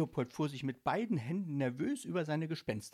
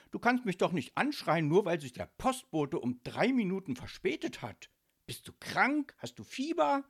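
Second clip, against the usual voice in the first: raised, high, affronted voice; loud retort; 8 semitones higher, 5 dB louder than usual. A narrator's reading. Micky objecting to being yelled at, loud retort. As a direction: call back loudly in protest. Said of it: "rief er laut zurück".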